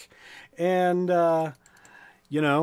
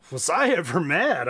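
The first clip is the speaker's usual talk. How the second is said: usual speaking voice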